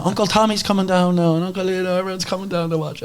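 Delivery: very high Galway mum voice; put-on high Galway chatter; Galway accent. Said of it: "There's my best Galway accent".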